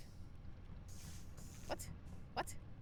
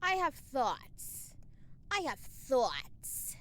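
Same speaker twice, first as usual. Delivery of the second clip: ominous musing; 2 semitones lower, 3 dB quieter, much slower than usual. Pretend.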